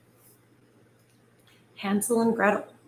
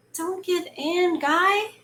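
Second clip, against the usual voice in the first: pleading; 8 semitones higher, slower than usual. Real, not acted. Pleading with character in story.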